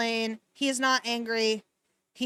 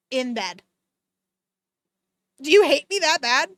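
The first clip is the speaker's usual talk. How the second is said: ordinary delivery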